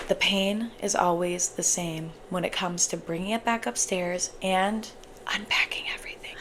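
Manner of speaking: hushed whisper of dread. Real, not acted. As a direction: whisper it like a confession. Whispered complaint about unpacking.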